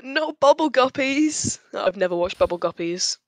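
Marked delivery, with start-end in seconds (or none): none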